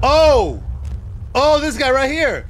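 loud, high cry of surprise; startled outburst. Real, not acted. Player spotting a figure, startled yell.

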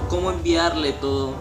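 normal talking voice

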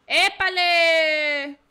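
loud, long-drawn shout; calling out. Real, not acted. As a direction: holler it, stretching the word out. Calling out during game action. (grito fuerte y alargado)

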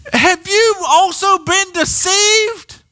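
mock accusing Pharisees. Pharisees answer the officers.

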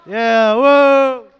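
loud whoop; excited cheer. Real, not acted. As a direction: cheer the winners loudly. Cheering at the end.

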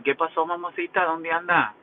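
sweet-talking text. Retold or imitated. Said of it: "le digo".

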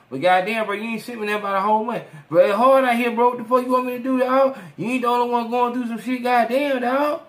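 heated argument with a jailed friend on the phone. Acted. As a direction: snap back at him, fed up. Phone quarrel heats up.

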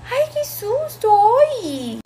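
high-pitched, startled exclamation, acted out; startled mother. Mother turns and finds a stranger behind her, startled. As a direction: imitate a mother jumping with surprise, voice shooting up.

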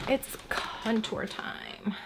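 slow unvoiced whisper; hushed slow makeup announcement. Real, not acted. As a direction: breathe it out slowly, no voice. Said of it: "whispered announcement".

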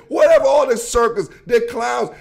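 scornful outburst about clowns; mocking shout. Real, not acted. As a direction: yell it out like you're laughing at a circus. Mocking the coach as a circus.

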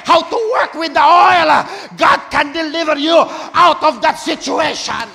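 booming proclaimed line; preaching shout. Real, not acted. Pastor shouting deliverance to the congregation, booming. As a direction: proclaim it full force to the congregation.

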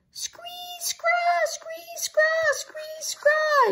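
very high seesawing bird shrieks; shrieking robin. A narrator's reading. Robin shrieks at the girls, screeching. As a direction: screech like a bird, thin then stronger. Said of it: "it shrieked at the girls".

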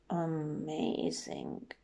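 low, soft and drawn out; hushed amazement. Acted. Gruffalo awed by the mouse, low hushed wonder. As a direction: breathe it slowly in awe.

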